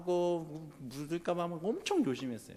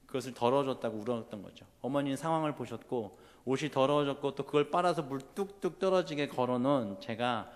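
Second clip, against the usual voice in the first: usual speaking voice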